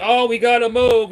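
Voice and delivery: high, loud mimicked outcry; mock alarm. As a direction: raise pitch and volume to parody the fuss. Mimicking radio operators in panic.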